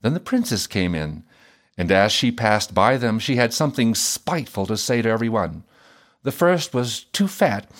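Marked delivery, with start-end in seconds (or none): none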